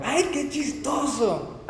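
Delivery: high, drawn-out, tickled exclamation; amused exclamation. Acted. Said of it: "Cuando dicen algo".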